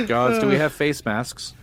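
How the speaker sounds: laughing. Acted. The mayor asking the guards for masks, laughing. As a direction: call for masks while cracking up.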